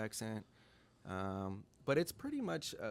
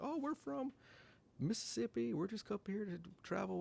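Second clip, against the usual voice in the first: high-pitched friendly traveler impression; high, easygoing small talk; chatty customer; 11 semitones higher than usual. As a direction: play a sociable out-of-towner. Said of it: "he's like".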